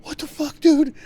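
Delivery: squeaky outraged yell; friend's retold disbelief, shrieked; high shocked yell. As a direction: yelp it way up high. Quoting Chris's shocked reply.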